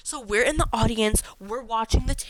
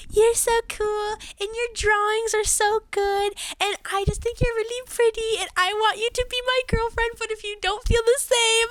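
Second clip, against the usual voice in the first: gushing crush; 12 semitones higher than usual. Retold or imitated. Reading the crush's confession text.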